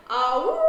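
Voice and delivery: loud rising howl; dog howl. A narrator's reading. Dog hears a fire engine at night, howling. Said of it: "she howls".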